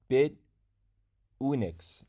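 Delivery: "Bit ... Unix", split by a clear pause; slowed-down pronunciation. Shown as a demonstration. Streamer repeating the name for viewers after rushing it, slowed-down pronunciation. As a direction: say "Bit", stop, then "Unix". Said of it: "I say it so fast".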